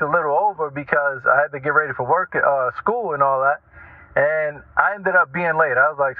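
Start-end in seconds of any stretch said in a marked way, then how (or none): none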